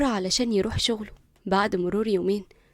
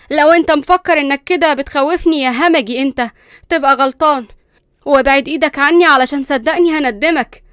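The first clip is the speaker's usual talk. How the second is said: loud, higher fake-brave retort; defiant bravado; 5 semitones higher, 10 dB louder than usual. Frightened Linda pretending to defy Qusay, loud. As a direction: hide the fear behind a loud challenge. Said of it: "حاولت تبين العكس".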